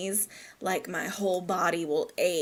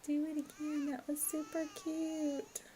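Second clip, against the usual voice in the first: cooing; 7 semitones higher, 10 dB quieter than usual. Real, not acted. Cooing at a cute Sim moment.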